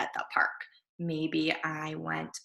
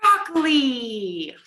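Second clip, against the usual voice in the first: loud, higher, slow "broccoli"; dramatic dread; 4 semitones higher, 6 dB louder, slower than usual. Acted. Boy dreading the broccoli dinner. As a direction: announce it like a doom.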